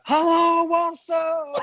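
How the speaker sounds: very high, drawn-out crowd greeting; mock stage shout. Imitating dad greeting the Warsaw crowd, hammed-up holler. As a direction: imitate an overexcited frontman hailing the crowd.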